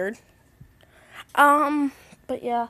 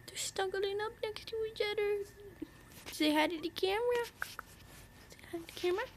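pet cooing; 8 semitones higher, 7 dB quieter than usual. Real, not acted. Owner to her snuggling pets, high soft cooing. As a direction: coo softly, pitched right up.

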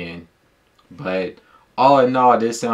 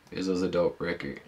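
"This was a dope record" repeated much softer, like a fading echo; softer repeated sign-off of the verdict; 3 semitones lower, 8 dB quieter than usual. Real, not acted. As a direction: let the line fall away to a quiet afterthought.

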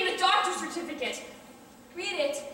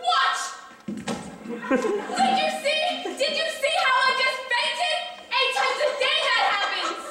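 excited; 7 semitones higher than usual. Acted. Woman shows fainting.

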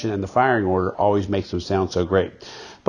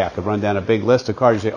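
normal talking voice

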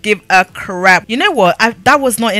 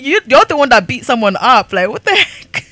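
ordinary talk, nothing marked

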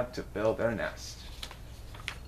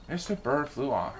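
usual speaking voice

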